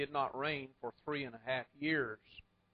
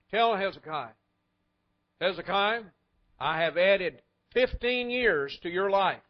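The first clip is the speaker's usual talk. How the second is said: booming divine promise; 3 semitones higher, 8 dB louder than usual. Acted. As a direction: announce it like a generous God. God sending good news.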